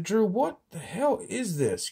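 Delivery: high, swooping baffled question; retold bafflement. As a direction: act out asking a friend, mystified. Retells his baffled question to Drew.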